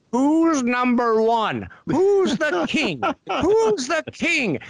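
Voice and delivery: put-on high, slow, grand old studio big shot; Sam Raimi's old-timey director voice. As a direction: lord it over them like a pompous old Hollywood director. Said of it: "old-timey Hollywood director voice".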